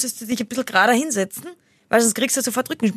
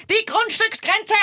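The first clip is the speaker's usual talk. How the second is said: much higher, slow shrill mock Lois; high slow cry as the sitcom mum; Lois impression; 12 semitones higher, slower than usual. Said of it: "Was Malcolm mittendrin?". (hohe, langsame Lois-Parodie)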